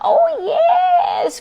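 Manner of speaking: high, loud delighted cry; excited cry. Acted. Witch welcoming the bird aboard, high delighted cry. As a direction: cry out with joy. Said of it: "cried the witch".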